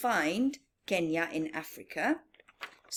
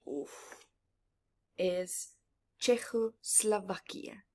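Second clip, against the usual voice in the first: whisper; 4 dB quieter, slower than usual. Acted. Dramatic map reveal.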